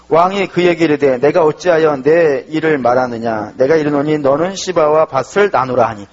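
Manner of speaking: 성경 구절을 천천히 또박또박 낭독; slow, measured Bible reading; scripture reading. Acted. Reads scripture aloud. (천천히 낭독하듯)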